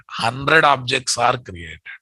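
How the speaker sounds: usual speaking voice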